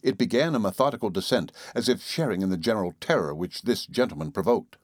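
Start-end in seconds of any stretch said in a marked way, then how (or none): none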